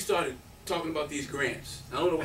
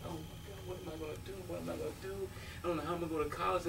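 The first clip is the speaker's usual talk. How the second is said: retold quiet panic; 3 semitones higher, 10 dB quieter, much faster than usual. Retells own panic as kid.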